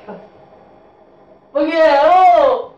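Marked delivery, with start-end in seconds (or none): 1.5-2.7 surprised shout. Real, not acted. Touching something wet and unknown.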